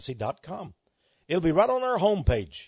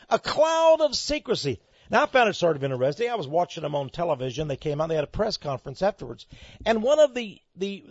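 ordinary delivery

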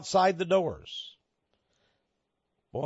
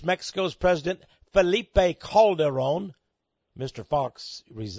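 normal talking voice

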